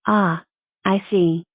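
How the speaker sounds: normal talking voice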